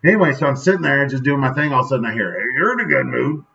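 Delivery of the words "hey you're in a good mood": country man. Retold or imitated. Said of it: "like, this little country man".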